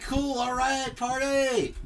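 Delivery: high, drawn-out party whoop; mock excited cheer. Kid told it's movie night, hammed-up excitement. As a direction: pitch it way up like an eager kid. Acts out his eager younger self.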